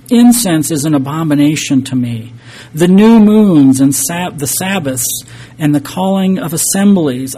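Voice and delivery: forceful scripture reading. Acted. Preacher reads God's complaint to the congregation, forceful and loud.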